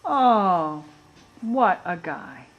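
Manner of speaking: ordinary delivery, nothing marked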